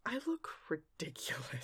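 dismayed realisation. Retold or imitated. Recapper plays a pony seeing her reflection, quietly dismayed. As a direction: drop the volume in deflation. Voices Twilight seeing herself.

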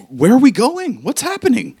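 a flustered man tagging along, lost; high, anxious questions from a clueless helper; confused Tobin. Acted.

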